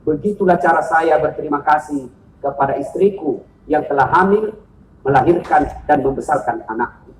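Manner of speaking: low, soft, tender reply; Umar's gentle answer. Retold or imitated. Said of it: "Dengar jawaban Umar".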